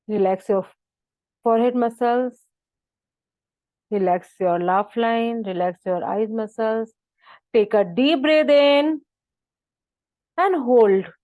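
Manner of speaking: slow, spaced relaxation cues; measured yoga teacher voice; guided meditation. Acted. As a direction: draw each phrase out, rising into the held breath. Leads relaxation and breathing.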